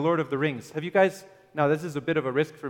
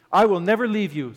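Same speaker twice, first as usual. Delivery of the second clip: louder, higher, stressing the promise; emphatic promise; 4 semitones higher, 6 dB louder than usual. Acted. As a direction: press the promise home. Repeated promise, stronger.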